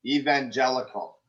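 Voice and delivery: "evangelical" opening with a long "ee". Shown as a demonstration. Director correcting the actress, "evangelical" with a long "ee" start. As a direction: say "evangelical" as "ee-van-JEL-ical". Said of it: "It's E, evangelical".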